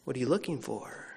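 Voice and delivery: low-key, soft "looking for"; soft inflection. Shown as a demonstration. Preacher trying out how Jesus perhaps said it, softly. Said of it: "put an inflection on it".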